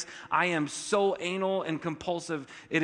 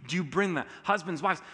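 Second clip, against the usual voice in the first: ordinary delivery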